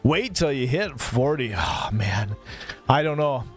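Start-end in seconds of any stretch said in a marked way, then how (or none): none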